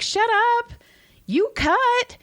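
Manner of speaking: very high-pitched retort; mock scolding comeback. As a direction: squeal it back at a teasing kid. Mom retells snapping at son.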